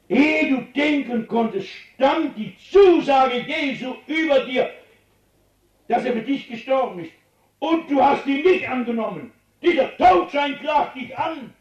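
God thunders the charge down on the sinner; thundering accusation. Acted. God's accusation, preaching full force.

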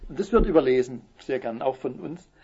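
normal talking voice

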